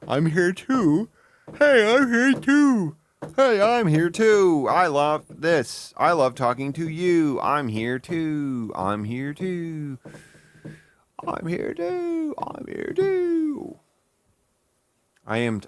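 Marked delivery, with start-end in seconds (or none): 0.1-10.0 slow, plaintive mock host, much higher; pleading host. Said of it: "be able to be like". 11.2-13.8 tiny squeak, very high and slow; squeaky high voice. Retold or imitated. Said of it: "be able to be like".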